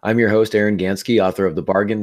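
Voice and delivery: ordinary talk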